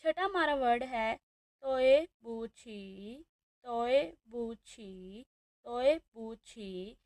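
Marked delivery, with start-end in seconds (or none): 1.6-7.0 तोए बूछी slow and drawn out; Chinese tones. Shown as a demonstration. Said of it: "तोए पर आ रही है last tone". (धीरे धीरे तोए बूछी)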